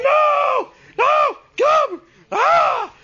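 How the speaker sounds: panicked screaming. Retold or imitated. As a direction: scream no at her. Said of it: "I'm like".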